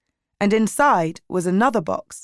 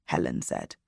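plain reading voice

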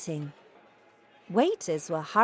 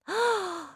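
quick high gasp of delight; sharp gasp; 13 semitones higher than usual. A narrator's reading. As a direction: gasp in sudden admiration. Grandmother reacts to plan.

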